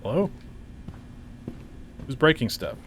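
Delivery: usual speaking voice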